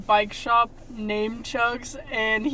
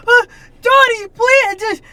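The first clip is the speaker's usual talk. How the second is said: high, loud panicked plea; frantic Pee-wee; 10 semitones higher, 10 dB louder than usual. Retold or imitated. Pee-wee begging Dottie about his stolen bike, frantic high plea.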